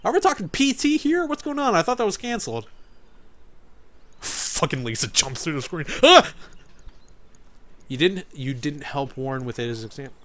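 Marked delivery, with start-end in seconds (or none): 6.0-6.3 startled yelp. Real, not acted. Lisa jumps through the screen.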